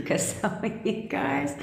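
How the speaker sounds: soft, high, slow words through laughter; real laughing. Real, not acted. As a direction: giggle helplessly through the words. Cracking up over listeners' behaviour.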